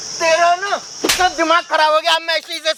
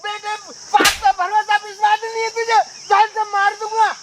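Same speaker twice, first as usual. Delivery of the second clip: angry outburst; 7 semitones higher than usual. Acted. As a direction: snap back at the son, offended. Father angry at accusing son. (गुस्से में ऊँची आवाज़ में)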